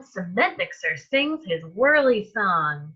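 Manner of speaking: ordinary delivery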